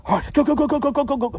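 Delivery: high, rapid-fire "go" chant; high-pitched, pushing pep talk; urgent self-coaxing. Retold or imitated. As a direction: urge it along in a frantic high voice. Urging himself to go while squatting.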